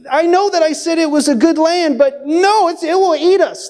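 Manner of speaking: high-pitched mock complaining; negative spy. Spy talking himself into negativity, high mock alarm. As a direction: wail it as an exaggerating naysayer. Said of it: "everything starts to become negative".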